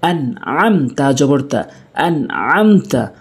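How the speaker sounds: "আনআমতা" with the ain squeezed hard; pressed ain. Shown as a demonstration. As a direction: press mid-throat in "আনআমতা" after the n. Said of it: "চাপ দিয়ে".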